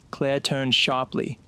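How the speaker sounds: ordinary delivery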